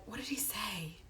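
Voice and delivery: whispered question to self; asking herself. Real, not acted. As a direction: whisper it as you search your memory. Asks herself, trying to recall.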